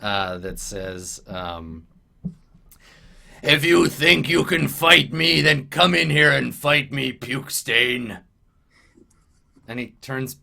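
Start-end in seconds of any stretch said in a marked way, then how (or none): 3.4-8.2 louder, rougher goblin chief calling through a door. Acted. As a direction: challenge him like a boss goblin behind the door. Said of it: "a loud goblin voice".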